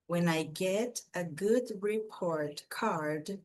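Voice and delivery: slow, careful read-aloud of an English text. Shown as a demonstration. Teacher reading the passage aloud for students, slow and clear. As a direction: read it slowly and clearly for learners to repeat.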